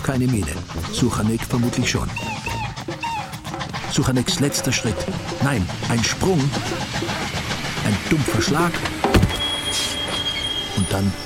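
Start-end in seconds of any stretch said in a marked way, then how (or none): none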